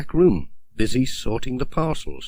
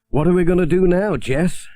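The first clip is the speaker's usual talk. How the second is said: fast, higher, louder fretful question; worried Pat; 8 semitones higher, 5 dB louder than usual. Acted. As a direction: panic a little about the stuck van. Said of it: "he said".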